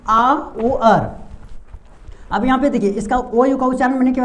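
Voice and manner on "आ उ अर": "आ उ अर" very slow, each vowel drawn out; slow sounding-out. Shown as a demonstration. Teacher answers how it is pronounced, "आ उ अर" slowly stretched. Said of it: "इसका उच्चारण क्या होगा?".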